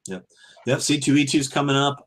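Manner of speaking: usual speaking voice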